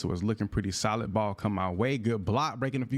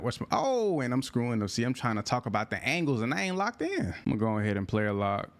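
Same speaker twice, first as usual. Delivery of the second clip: ordinary talk, nothing marked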